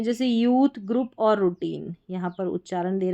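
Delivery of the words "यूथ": यूथ with a long "oo"; long "oo" sound. Shown as a demonstration. Teacher gives the first example, long "oo". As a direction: draw out the vowel in यूथ. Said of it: "ओयू को ऊ के रूप में".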